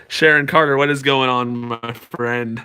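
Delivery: normal talking voice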